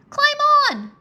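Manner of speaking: high, loud urgent yell. A narrator's reading. Rory calling Drake onto the dragon, a high loud yell. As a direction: shout it down from the dragon's back. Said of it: "Rory yelled".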